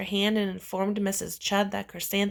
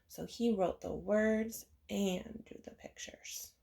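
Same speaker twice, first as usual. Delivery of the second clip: soft whispered aside; 8 dB quieter than usual. Real, not acted. Whispering an aside to the listening kids. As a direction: lean in and whisper it.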